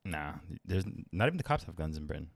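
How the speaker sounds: ordinary talk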